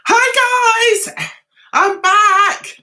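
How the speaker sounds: high, loud cheery hello; bright greeting. Real, not acted. Host greeting live viewers, loud and high. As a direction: sing out the welcome to viewers.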